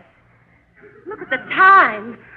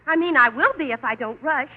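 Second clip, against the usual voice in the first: ordinary talk, nothing marked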